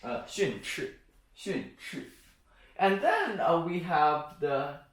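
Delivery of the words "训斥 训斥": "训斥" with both syllables falling; fourth-tone demo. Shown as a demonstration. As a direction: model "训斥", a separate fall on each syllable. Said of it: "And finally".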